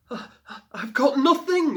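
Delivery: high, loud breathless cry; desperate gasp. A narrator's reading. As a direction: blurt it out in panic. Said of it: "Lila gasped".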